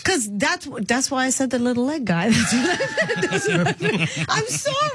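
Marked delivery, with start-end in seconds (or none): 2.3-4.9 words breaking up in real laughter, pitch climbing high; can't get the words out for giggling; laughing too hard to finish the sentence. Real, not acted. Host cracks up mid-sentence.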